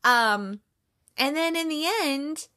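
ordinary delivery

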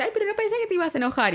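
lightly voiced high Fiona, sweetly surprised; Fiona's voice. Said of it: "Encima Fiona le dice".

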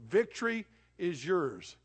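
ordinary talk

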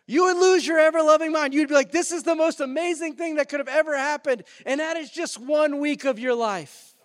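loud, high, excited preacher; fired-up preaching. Acted. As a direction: preach it at full force, building on amazement. Preaches at full force.

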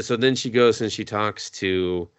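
normal talking voice

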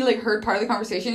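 ordinary delivery

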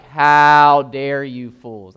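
slow, loud mock scolding; hammed-up slow, loud rebuke; mock harsh rebuke. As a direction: drag it out like a stern judge. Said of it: "He's not like".

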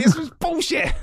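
high, loud indignant blurt; really fed up, spits it out; angry outburst. Real, not acted. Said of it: "'cause it annoyed me that much".

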